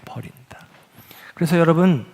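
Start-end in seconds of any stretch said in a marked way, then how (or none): 0.1-0.7 whisper. Shown as a demonstration. Whispered key word.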